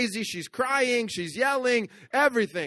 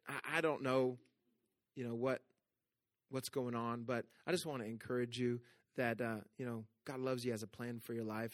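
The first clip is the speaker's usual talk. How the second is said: much softer, gentle reassuring words; soft encouragement; 8 semitones lower, 14 dB quieter than usual. Retold or imitated. Pastor telling the crying mother that God loves her, soft and tender. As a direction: quietly comfort a stranger in distress.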